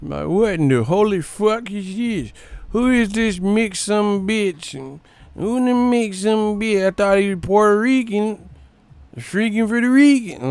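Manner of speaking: high, twangy mock Texan yelling; angry Texan crowd. Streamer imitating angry Texans in a hammed-up Southern twang. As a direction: ham up an outraged Southern local.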